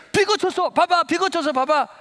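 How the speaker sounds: very high, urgent acted shouts; excited exclaiming. Student showing classmate the rain stopped, wildly excited. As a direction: burst out as if tapping a friend to look.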